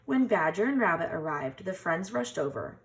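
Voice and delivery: ordinary delivery, nothing marked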